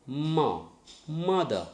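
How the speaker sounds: Mother with MO punched, then falling away; Mother, strong MO and light falling second syllable; stress on first syllable. Shown as a demonstration. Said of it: "stress should be on the first syllable".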